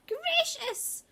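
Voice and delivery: jolted by a thump at her feet; startled cry. A narrator's reading. Old lady startled by the owl landing, very high cry. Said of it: "cried the old lady".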